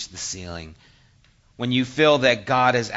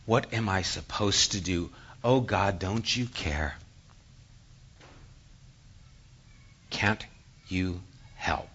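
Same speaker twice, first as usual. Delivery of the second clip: quiet plea to God; 6 semitones lower, 8 dB quieter than usual. Acted. Sufferer asking God why this happens, low weary plea. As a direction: ask God quietly, leaving long heavy pauses. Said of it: "and you say".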